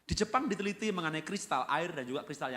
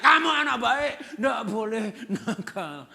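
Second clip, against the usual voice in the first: loud, much higher shout of praise; 8 semitones higher, 12 dB louder, slower than usual. Acted. Parent shouting a positive rebuke at a naughty child. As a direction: yell the kind words at the child. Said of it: "Jadi lebih baik teriak".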